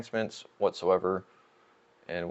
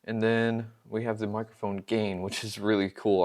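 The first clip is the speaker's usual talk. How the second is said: ordinary talk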